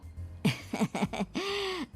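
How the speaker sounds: a high, quiet gloating laugh; soft mocking laugh to the cornered groom's family; smug chuckle. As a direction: chuckle at their trap, pleased with yourself. Bride's father mocks groom.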